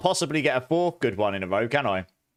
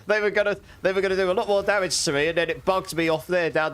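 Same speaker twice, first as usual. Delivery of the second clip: laughing; 6 semitones higher than usual. Real, not acted. Laughing at game bug.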